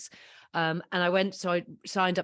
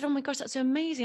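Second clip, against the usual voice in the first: excited; 9 semitones higher, faster than usual. Retold or imitated. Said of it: "then I said".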